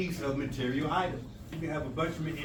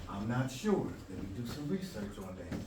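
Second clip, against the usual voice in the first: softer, lower, measured reply; calm, quiet answer; 8 semitones lower, 6 dB quieter than usual. Acted. Student admitting doubt to a teacher, quiet and calm. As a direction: say it quietly, as if noting it down.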